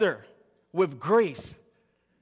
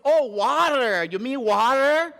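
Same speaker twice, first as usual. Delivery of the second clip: hammed-up American "water", loud, slow, stretched r; mock American accent; 5 semitones higher, 5 dB louder, slower than usual. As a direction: imitate an American drawing out "water", higher and louder. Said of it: "Then she said".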